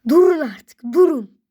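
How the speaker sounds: high loud shout; yelling stop. Real, not acted. Blocks toppling, kid shouts at them to stop.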